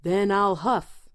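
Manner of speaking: slow, loud wolf voice; roaring wolf. A narrator's reading. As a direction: huff and puff it out slowly. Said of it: "roared the wolf".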